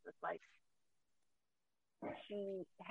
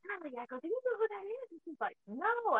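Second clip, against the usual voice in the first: animated retelling; 11 semitones higher than usual. Retold or imitated. Mother and daughter retold exchange.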